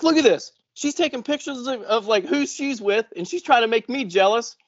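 mock whiny old guy; mock old guy complaining. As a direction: act out the jealous old man. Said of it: "he's like".

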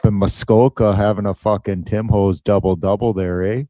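hammed-up Canadian accent; bad Canadian accent. Co-host joking about Muskoka, terrible Canadian accent. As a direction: lay on a dubious Canadian accent thick. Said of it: "That's not even a Canadian accent".

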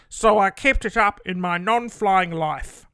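loud put-on old man voice. Imitating dad as an old man explaining his notepad. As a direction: speak as your elderly dad. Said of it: "How do you do an old man?".

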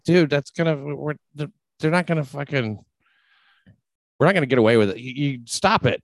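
high urgent warning to cousin; alarmed pleading. Retold or imitated.

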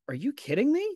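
high, imitating the coach's shocked disbelief; retold disbelief. Coach reacts to the news, mock stunned disbelief. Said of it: "And he's like".

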